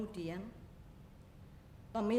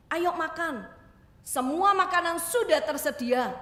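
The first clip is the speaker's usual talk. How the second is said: eager welcome; 7 semitones higher, 10 dB louder than usual. Acted. Mother welcomes returning child.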